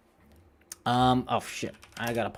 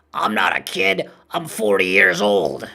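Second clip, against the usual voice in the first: loud, higher, hammed-up cartoon protest; indignant cartoon character; 4 semitones higher, 7 dB louder than usual. Imitating cartoon man mistaken for a kid, indignant. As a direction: belt it out, stretching the age. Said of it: "he's like".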